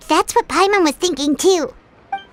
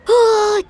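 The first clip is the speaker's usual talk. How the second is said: startled gasp; 5 dB louder than usual. Acted. Paimon startled by spooky dream.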